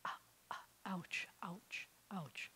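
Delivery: "ouch" whispered with the throat closing and opening first; whispered glottal stop demo. Shown as a demonstration. Teacher demonstrating the glottal stop, whispered. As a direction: breathe "ah" and "ouch" with a clean catch in the throat.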